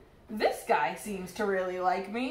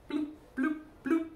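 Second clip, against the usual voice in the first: bubble talk; 7 semitones higher, 4 dB quieter than usual. Acted. Fish voices bubbling sounds.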